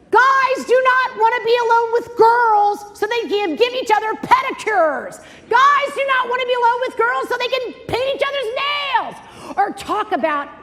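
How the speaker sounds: loud, high-pitched exasperated rant; exasperated shouting. Real, not acted. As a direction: shout it high to drive it home. Emphatic shouted lecture to mothers.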